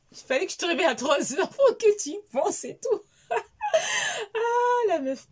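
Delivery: high laughter through the words; laughing hard. Real, not acted. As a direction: crack up while telling it. Rit en racontant. (fou rire aigu)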